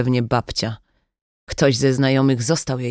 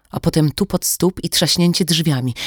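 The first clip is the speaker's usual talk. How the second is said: ordinary delivery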